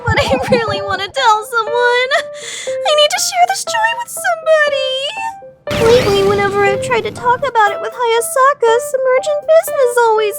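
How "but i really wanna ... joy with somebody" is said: high-pitched desperate whine; bursting to tell. Acted. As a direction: wail it, aching to share the joy. Longing outburst in inner monologue.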